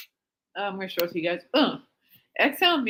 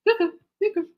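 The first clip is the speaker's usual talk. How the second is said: "Cuckoo, Cuckoo" sung much higher, like a cuckoo clock bird; cuckoo call; 11 semitones higher, faster than usual. Shown as a demonstration. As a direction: chirp "Cuckoo, Cuckoo" as a two-note bird call. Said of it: "it sounds like Cuckoo".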